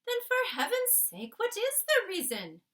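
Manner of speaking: high, loud alarmed demand; the queen startled, pressing for answers. Acted. Queen alarmed by frightened maid.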